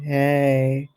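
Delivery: usual speaking voice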